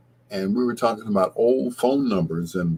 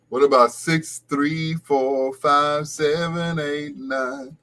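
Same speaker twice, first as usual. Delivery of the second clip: slow put-on voice; 6 semitones higher, slower than usual. Slow put-on voice reading number.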